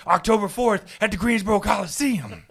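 loud, high hyped promo shout; wrestling promo. Retold or imitated. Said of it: "a wrestling promo element to it".

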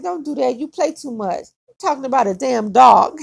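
a hint of a fed-up dad; mock scolding dad. Dad scolding about the dog.

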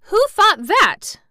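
high, loud, slow indignant demand; outraged boy; angry. A narrator's reading. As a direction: demand it angrily, voice shooting up. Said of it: "said Colin angrily".

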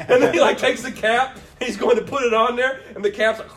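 ordinary talk

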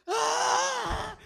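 sharp high yelp of pain, retold; high scream. Retelling the ankle sprain to a friend, high yelp. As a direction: let out a high pained scream. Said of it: "I screamed like a bitch".

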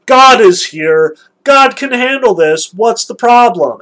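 mock exasperated Moses. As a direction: throw up your hands at the grumbling. Moses voiced in retelling.

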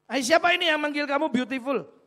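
loud, high angry husband waking his wife; husband roused in the night, shouting at his wife. Retold or imitated. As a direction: snap at her, jealous and loud. Husband repeats accusation.

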